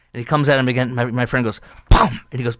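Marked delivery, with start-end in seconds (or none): 1.9-2.2 loud, sudden "boom" impact; punchy vocal sound effect; imitated punch noise. Imitating friend's second punch.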